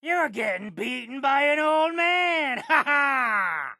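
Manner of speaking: gloating laugh. Acted. Old man gloating mid-fight.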